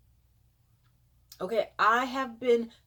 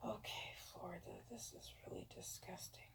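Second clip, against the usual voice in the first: whispered disgust; hushed appalled whisper; 22 dB quieter, faster than usual. Real, not acted. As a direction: whisper it with distaste. Reacting to Florida numbers.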